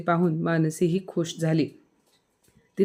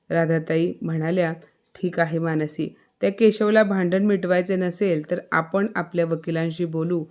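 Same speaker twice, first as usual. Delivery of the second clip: usual speaking voice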